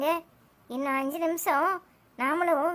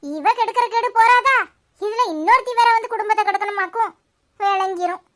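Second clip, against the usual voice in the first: angry grumble; 4 semitones higher, 6 dB louder than usual. Acted. Angry complaint about women.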